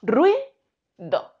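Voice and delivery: Ruido with ui gliding as one beat; diphthong demo. Shown as a demonstration. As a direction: say ruido as two syllables. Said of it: "formando este diptongo".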